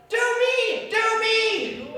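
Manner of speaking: put-on high, loud child begging from a tree; a boy calling down to be included; eager kid yelling.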